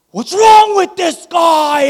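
re-enacted furious yell, stretched out; animal outburst. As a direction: explode at a bad driver. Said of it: "the animal came out of me".

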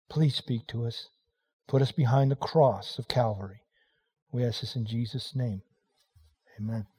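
low, hushed prayer voice. Acted. Pastor praying over the congregation, low and hushed. Opening prayer before the sermon.

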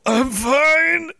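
high-pitched mock denial; strained denial. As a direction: insist it's nothing while straining. Acts out bro insisting he's fine.

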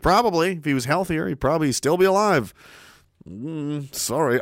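ordinary talk, nothing marked

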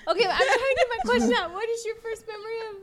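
high, fast, laughing through it. Real, not acted. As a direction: fight back giggles. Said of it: "laughing while trying to ask".

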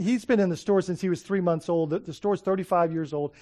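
usual speaking voice

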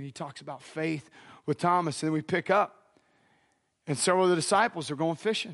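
normal talking voice

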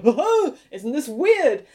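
high, swooping "oh" of fake wonder; hammed-up naive amazement; mock astonishment. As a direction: make fun of wide-eyed surprise. Mocking time travellers' naive astonishment.

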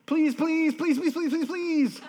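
put-on high, loud whiny child pleading; begging kid. Preacher mimicking his kids begging, high whiny childish pleading. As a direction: beg like a child who wants something badly. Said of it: "Our kids do it".

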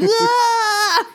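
a sudden high-pitched "Ah!" scream. Pretend. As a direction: shriek like you're blasting off.

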